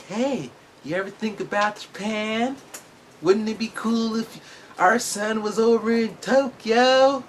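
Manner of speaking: high, slow, sweetly persuasive mom; put-on mom voice. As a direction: coax it like a scheming mom. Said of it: "My mom doesn't talk like that".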